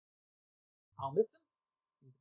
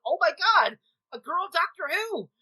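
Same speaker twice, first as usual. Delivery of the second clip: higher and louder, bursting with delight; thrilled gasp; 9 semitones higher, 10 dB louder than usual. Retold or imitated. Retelling seeing Billie Piper cast as the Doctor, thrilled. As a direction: squeal it like the best news ever. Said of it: "I was like".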